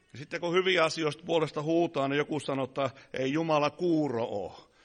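ordinary talk

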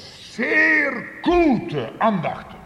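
"Sehr gute" drawn out high and loud; emphatic preaching. Real, not acted. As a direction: slowly belt out "Sehr gute" for the congregation. Emphatic verdict in sermon. („Sehr gute“ laut, hoch und gedehnt betont)

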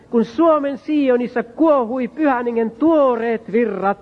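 rising preacher's prayer to the Lord; fervent prayer. Acted. Preacher praying aloud before the congregation, fervent. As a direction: pray aloud to the Saviour with growing fervour.

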